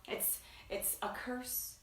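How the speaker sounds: soft, unvoiced aside; mock whisper. Whispering a mock confession to the producer. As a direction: whisper it like a secret. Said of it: "What do you think it is?".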